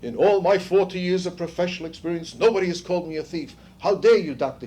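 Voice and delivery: imitating a German-accented outraged Bettelheim; lightly put-on German accent, rising to an outraged how dare you. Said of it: "rushed to the microphone in his German accent".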